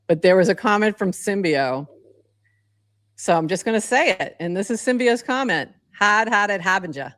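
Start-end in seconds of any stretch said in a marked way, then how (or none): 5.9-7.1 Massachusetts accent with dropped r's; old Boston accent. Shown as a demonstration. Podcaster reading a viewer's comment in her old Massachusetts accent. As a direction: say it like a Boston-area local. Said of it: "that's how I would say that".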